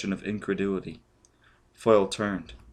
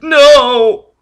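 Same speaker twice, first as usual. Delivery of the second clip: much higher, much louder scream; terrified scream; 17 dB louder than usual. Acted. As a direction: shriek it in pure panic. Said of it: "Forrest screamed".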